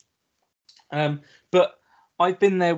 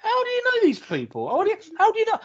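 much higher, squeaky mock disbelief; mock squeak; 3 dB louder, faster than usual. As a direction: squeal the question in mock amazement. Mocking own disbelief.

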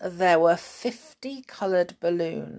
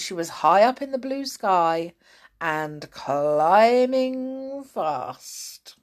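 plain reading voice

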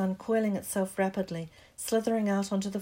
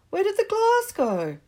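high, loud disbelief; stunned, can't believe it; shocked; 14 semitones higher, 11 dB louder than usual. Acted. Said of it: "was in shock".

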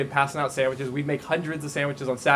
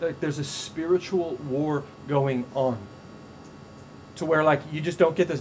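ordinary talk, nothing marked

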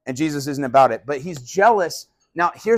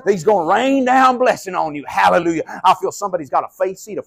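a hint of a Southern TV preacher, raised and fast; mock Southern TV preacher; 3 semitones higher, 3 dB louder than usual. Pastor mocking a TV preacher, in a light Southern preacher voice. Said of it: "why they're always from the South".

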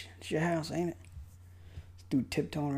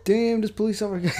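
laughing; 8 semitones higher, 8 dB louder than usual. Real, not acted. Laughs at cop's nose.

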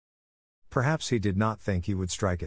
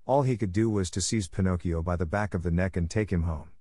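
ordinary delivery, nothing marked

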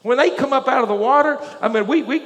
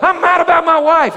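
loud, raised-pitch angry complaint; acted-out mad husband, voice up; retold angry outburst; 6 semitones higher, 6 dB louder than usual. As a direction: imitate a husband fuming about his wife. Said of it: "He was mad".

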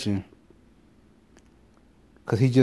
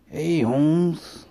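higher, hammed-up Spanish accent; a badly faked Spanish accent; mock Spanish accent; 5 semitones higher than usual. Bragging about accents to his wife, mock Spanish.

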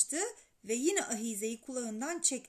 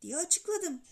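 usual speaking voice

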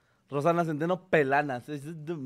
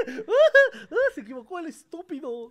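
high, loud burst of laughter; laughing hard; 12 semitones higher, 7 dB louder than usual. Real, not acted. Mocking co-host's slip with high loud laughter. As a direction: cackle at his mistake.

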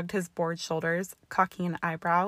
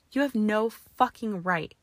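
angry; 3 semitones higher, 6 dB louder than usual. Acted. Draco enraged.

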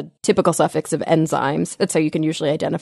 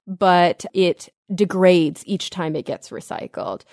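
ordinary talk, nothing marked

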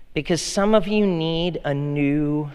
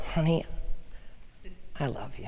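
much softer, tender and intimate; tender to wife; 10 dB quieter, faster than usual. Retold or imitated. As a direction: say it softly to your wife. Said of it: "And I go".

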